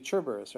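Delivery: Cerberus with a "ch" at the start; Italian soft C in Cerberus; Italian pronunciation. Shown as a demonstration. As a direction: say Cerberus as "CHER-ber-us". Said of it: "In Italian, it would be".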